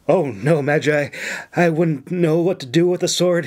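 ordinary delivery, nothing marked

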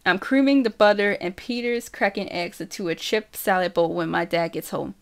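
ordinary delivery

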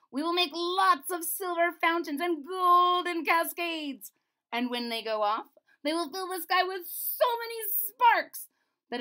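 high, loud, eager voice; the mathematician proudly presents fireworks; royal mathematician. A narrator's reading. Mathematician character voice.

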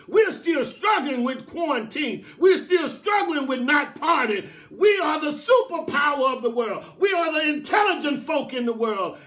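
loud, rising sermon cadence; impassioned preaching. Real, not acted. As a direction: preach it with mounting, punchy emphasis.

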